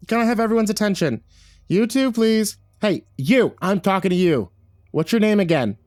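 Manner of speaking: high, insistent, officious call; calling for attention. Acted. Assistant director demanding everyone's attention, calling out high. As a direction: project across the room and single someone out.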